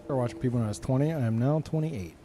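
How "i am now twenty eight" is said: normal talking voice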